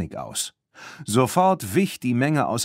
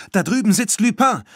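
hoch und laut, ganz aufgeregt; 10 semitones higher, 5 dB louder than usual. A narrator's reading. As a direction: aufgeregt rufen, weil sie ihn entdeckt hat. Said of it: "sagte sie aufgeregt".

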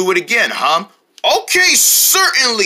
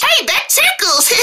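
talking toy voice; 13 semitones higher, slower than usual. Acted. Pressed toy says its phrase.

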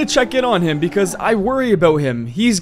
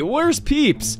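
ordinary talk

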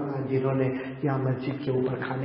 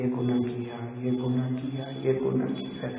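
low, soft, repetitive listing; subdued low recital; reckoning up sins; 3 semitones lower, 5 dB quieter, slower than usual. Acted. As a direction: count off each sin quietly. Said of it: "پھر اللہ گھلانا شروع کریں گے".

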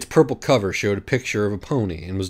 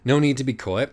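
ordinary delivery

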